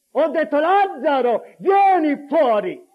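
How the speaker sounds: high, loud, insistent summons; commanding cry. Acted. Jesus answering doubters by calling the dead man out, commanding. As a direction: repeat the order at full force.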